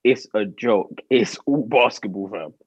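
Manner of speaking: ordinary talk, nothing marked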